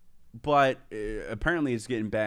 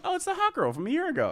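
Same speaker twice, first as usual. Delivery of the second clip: surprised; faster than usual. Retold or imitated. Recognising girl on TikTok, surprised.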